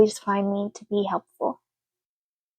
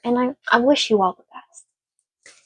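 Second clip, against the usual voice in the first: ordinary talk, nothing marked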